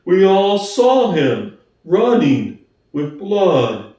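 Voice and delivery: plodding, honest workhorse; Boxer's slow voice. Acted.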